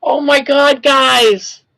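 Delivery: loud, thrilled exclamation; a shout of pure astonishment; excited outburst. Real, not acted. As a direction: burst out in real disbelief. Shocked by news about her songs.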